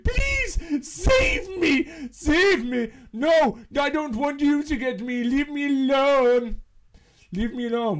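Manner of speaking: high, shrill-free mock cry for help; mock pleading chair. Player voicing the chair, mock pleading to be saved. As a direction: ham up a chair begging to be saved. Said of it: "You were like".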